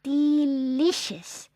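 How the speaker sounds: high, very drawn-out, blissful; happy sigh. A narrator's reading. Barbara answers the sunlight with a long, happy sigh. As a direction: sigh it happily, stretching the word out high. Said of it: "with a happy sigh".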